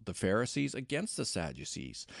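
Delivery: ordinary delivery, nothing marked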